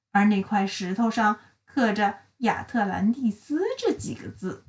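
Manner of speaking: ordinary delivery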